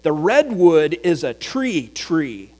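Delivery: slow, with "tree tree" leaning on the repeat; slowed demo, "tree tree" weighted; doubled word stressed. Shown as a demonstration. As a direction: draw out "tree tree" to show the emphasis. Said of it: "they might say something like this".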